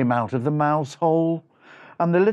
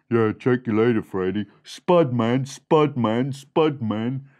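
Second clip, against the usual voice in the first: low street-slang mouse farewell; deep-voiced brother; 6 semitones lower than usual. Other mouse brother bidding Freddy goodbye, deep-voiced. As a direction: echo the cool sign-off down low.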